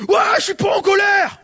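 high furious shout of denial; angry yell. Retold or imitated. Angry woman yelling she isn't angry. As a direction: scream the denial back. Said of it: "elle hurlait".

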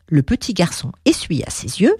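plain reading voice

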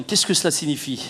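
ordinary talk, nothing marked

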